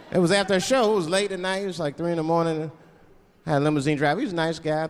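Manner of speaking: ordinary talk, nothing marked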